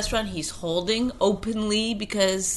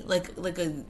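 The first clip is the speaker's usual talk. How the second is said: ordinary talk, nothing marked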